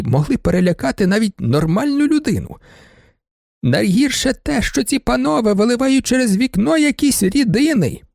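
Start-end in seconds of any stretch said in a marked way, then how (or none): 3.6-8.0 put-on high, complaining old lady; indignant baroness. Baroness complains to the judge about students, high and aggrieved. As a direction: whine out her grievances, high-pitched.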